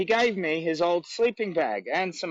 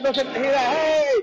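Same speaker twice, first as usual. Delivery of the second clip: calling out to passers-by, louder and higher; 8 semitones higher, 4 dB louder than usual. Real, not acted. Walker hailing people across the street, loud call. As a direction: hail someone down the road, hey.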